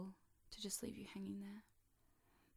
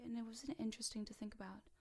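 ordinary talk

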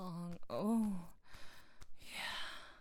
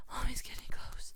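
"Mommy's getting close" pushed higher and louder, breath rising; louder urgent whisper; 6 semitones higher, 7 dB louder than usual. Acted. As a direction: build the whisper into a near cry. Rising toward climax.

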